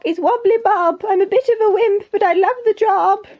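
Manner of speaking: high-pitched timid cook voice; wimpy cook. Acted. Wimpy cook introducing himself to the king, high character voice. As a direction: pitch it up like a storybook wimp.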